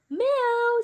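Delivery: high mewing cat; a quick cat meow. Acted.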